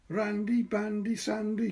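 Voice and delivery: put-on high, loud chant of a little boy; sing-song rhyme shouted from the coal hole; crying out. A narrator's reading. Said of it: "cried the little boy".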